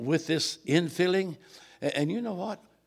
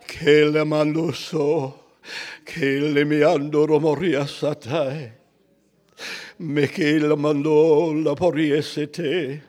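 slow, intoned prayer, fairly loud; praying in tongues; 7 dB louder, slower than usual. Real, not acted. Preacher continuing in tongues, slow and sustained. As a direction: intone each phrase slowly, drawing out vowels.

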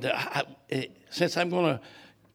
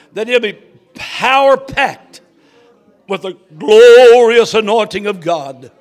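loud, higher, building fervent prayer; full-force preaching prayer; 5 semitones higher, 16 dB louder, slower than usual. Real, not acted. Preacher praying over tonight's service, fervent and very loud. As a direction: cry out the prayer with rising force.

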